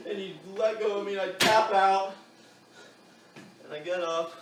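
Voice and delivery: ordinary delivery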